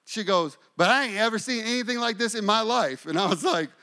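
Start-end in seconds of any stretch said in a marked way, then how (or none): 0.8-3.1 high, quick imitated Southern accent; little Southern voice. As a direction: rattle it off as a Southern woman. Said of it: "in her little southern voice".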